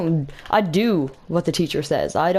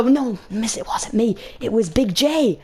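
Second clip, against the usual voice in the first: protesting pupil; 5 semitones higher, slower than usual. Retold or imitated. Acted protest to the teacher.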